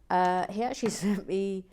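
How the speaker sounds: normal talking voice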